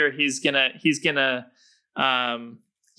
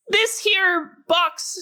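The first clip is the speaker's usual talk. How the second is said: halfling rogue voice; 3 dB louder than usual. Acted. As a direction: pound the table and declare it in a high voice. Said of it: "pounds his little halfling fist on the table and says".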